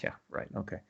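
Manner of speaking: whispered, dry disbelief; unvoiced doubtful aside; sceptical whisper. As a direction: breathe it out like you don't buy it. His sceptical reply retold.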